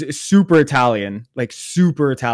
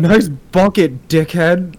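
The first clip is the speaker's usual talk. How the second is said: drawn-out, higher-pitched jeer; mocking chirp; 4 semitones higher, 4 dB louder, much slower than usual. Stranger heckling a man with his popcorn bucket, mocking chirp. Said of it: "The chirps".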